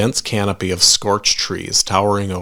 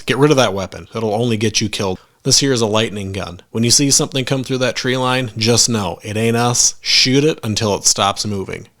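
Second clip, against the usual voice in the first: plain reading voice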